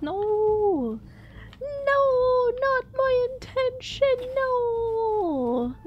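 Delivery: high, drawn-out wailing "no"; mock horror. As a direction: protest in hammed-up high-pitched despair. Acts own horrified reply to accusation.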